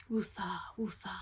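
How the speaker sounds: whispered woosah on a long breath; calming whisper. Real, not acted. Host steadying herself after a sad scene, whispered breathing out. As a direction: breathe it out to calm down. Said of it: "let it out".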